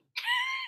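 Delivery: very high, soft giggle; quick squeak of laughter. Real, not acted. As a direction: laugh at the absurdity. Laughing at his reply.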